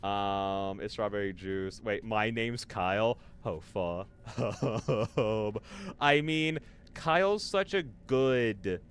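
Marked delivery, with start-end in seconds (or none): none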